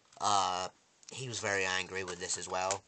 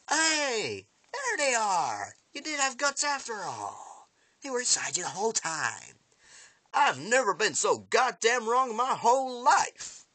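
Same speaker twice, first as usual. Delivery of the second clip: loud, high-pitched mock astonishment; gloating shout; 8 dB louder than usual. Attacker taunts Spencer after gutting him, gloating shout. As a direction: crow it out at full voice, hammed up.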